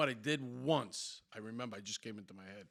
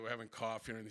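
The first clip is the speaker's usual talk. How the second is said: usual speaking voice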